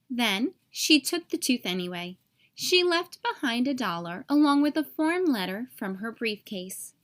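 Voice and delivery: usual speaking voice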